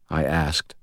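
usual speaking voice

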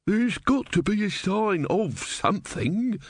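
put-on slow elderly musing; elderly man. A narrator's reading. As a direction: muse slowly like an old man. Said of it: "said the elderly man".

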